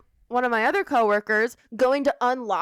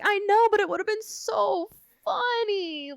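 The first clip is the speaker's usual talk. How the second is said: mock whine; 8 semitones higher, slower than usual. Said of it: "I'm like".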